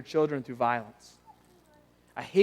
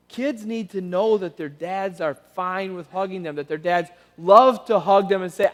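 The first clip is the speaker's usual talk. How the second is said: usual speaking voice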